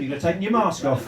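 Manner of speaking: usual speaking voice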